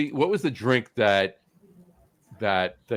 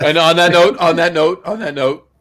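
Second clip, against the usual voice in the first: announcing; 8 semitones higher, 7 dB louder than usual. Real, not acted. Host cutting to a toast.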